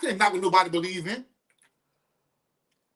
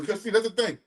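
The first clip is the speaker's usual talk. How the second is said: normal talking voice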